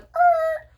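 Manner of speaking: very high startled squeak; shocked mom squeak. Retold or imitated. Mom in the car hearing about the closet, shocked squeak. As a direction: let out a stunned little squeak. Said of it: "And my mom's like".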